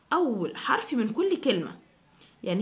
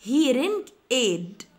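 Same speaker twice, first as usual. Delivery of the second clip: hearing aid said slowly and a bit louder; slow model; 4 dB louder, much slower than usual. Shown as a demonstration. Teacher repeating the word slowly for students. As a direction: stretch it and project. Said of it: "repeated slowly".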